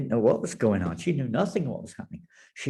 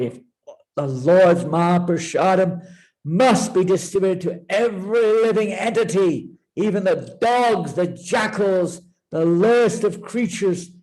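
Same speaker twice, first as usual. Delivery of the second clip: loud, high, vowing fury; in a rage; 5 semitones higher, 4 dB louder than usual. Acted. Parvati vowing to destroy the universe, enraged and loud. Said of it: "And she was in a rage".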